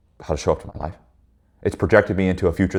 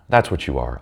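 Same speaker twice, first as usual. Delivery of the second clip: normal talking voice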